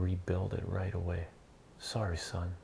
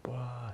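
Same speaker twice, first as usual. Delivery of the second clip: higher-pitched fake bawl; mock crying wail; 4 semitones higher, 3 dB quieter than usual. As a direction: bawl like a child, half-hearted. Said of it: "he's still crying".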